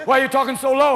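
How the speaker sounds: ordinary talk, nothing marked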